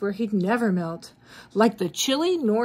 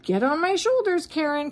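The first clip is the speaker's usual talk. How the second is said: calling out; 7 semitones higher than usual. Acted. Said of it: "cried Frosty".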